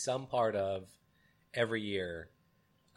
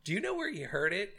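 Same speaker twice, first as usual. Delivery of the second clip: much higher gossipy mom; 9 semitones higher than usual. Retold or imitated. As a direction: ask it like a nosy mother on the phone. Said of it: "he goes".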